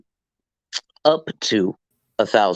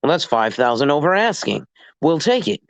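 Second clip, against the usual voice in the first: lightly voiced eager agent, pitched higher; a hint of a pleased agent jumping at the offer; eager seller's agent; 6 semitones higher than usual. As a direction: play the seller's agent snapping up the deal. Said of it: "He's gonna go".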